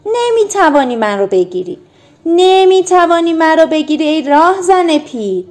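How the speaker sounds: high, loud playful taunt; taunting shout. A narrator's reading. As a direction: tease the robber as you run. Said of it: "تیم فریاد زد".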